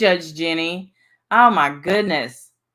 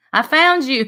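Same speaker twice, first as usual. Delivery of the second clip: bright, raised call of discovery; excited shout-out; 7 semitones higher, 4 dB louder than usual. Real, not acted. Host greeting a viewer in chat, excited. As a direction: greet her like you spotted her at last.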